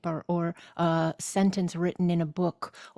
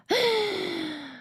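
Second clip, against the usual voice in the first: high, audible gasp; gasping inhale; 13 semitones higher than usual. Real, not acted. Teacher over-accentuating the breath, with a high gasp. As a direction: draw breath in sharply and audibly. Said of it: "It sounds like I'm gasping".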